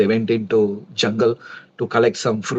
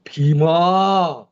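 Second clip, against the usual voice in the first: a loud storytelling cry, pitched up a little; calling out Bhima's name; 3 semitones higher, 4 dB louder than usual. Retold or imitated. Draupadi, in danger, screams for Bhima to come. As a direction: call her husband from across the jungle. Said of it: "then Draupadi screamed".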